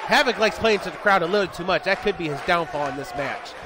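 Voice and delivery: fast, lively ringside match call; play-by-play. Acted. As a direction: call the match live as it happens. Live wrestling play-by-play.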